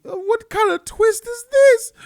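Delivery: can't believe this twist; incredulous. Real, not acted. Reviewer questioning the twist, loud incredulous outburst.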